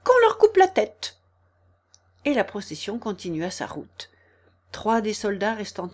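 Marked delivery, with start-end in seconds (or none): 0.0-1.2 high, loud queenly command; shouted order. A narrator's reading. Queen ordering gardeners beheaded, high shout. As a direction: scream out the sentence.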